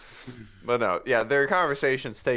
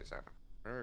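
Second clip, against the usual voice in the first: whispered name; 20 dB quieter than usual. Acted. Puss pleading quietly.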